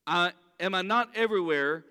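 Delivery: ordinary talk, nothing marked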